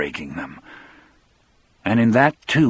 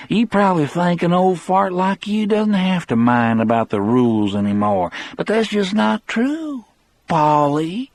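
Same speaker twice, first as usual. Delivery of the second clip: Brad's voice; 9 semitones higher than usual. A narrator's reading. Brad sneers at old Paul.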